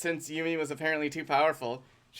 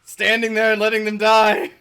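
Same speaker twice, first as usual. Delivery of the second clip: loud, higher frustrated yell; real exasperated outburst; exasperated shout; 7 semitones higher, 13 dB louder than usual. Real, not acted. Frustrated at teammate.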